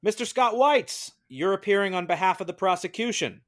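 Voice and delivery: ordinary talk, nothing marked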